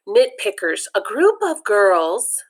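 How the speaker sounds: ordinary delivery, nothing marked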